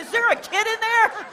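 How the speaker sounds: high, loud startled shout; alarmed guard. Retold or imitated. Airport guard hears a voice from the bag, startled high shout. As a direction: shriek it like the guard just heard a child in the bag.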